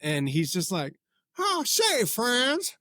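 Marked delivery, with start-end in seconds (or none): 1.4-2.8 very high, bouncing singer voice. Retold or imitated. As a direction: speak in wavering singsong, way up high. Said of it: "he should always be bouncing when he talks".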